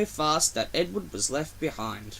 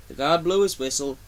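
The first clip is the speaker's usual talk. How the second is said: usual speaking voice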